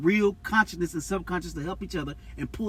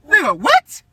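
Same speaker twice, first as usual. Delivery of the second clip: high shocked "what?"; shocked disbelief; 8 dB louder, slower than usual. Real, not acted. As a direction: squeal it in disbelief. Shocked outburst at claim.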